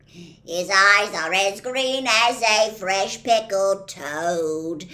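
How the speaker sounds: loud, slow gruff dwarf reciting; hammed-up gruff love recital; recited love poem. A narrator's reading. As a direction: declaim the poem with grand mock feeling. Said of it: "singing valentine".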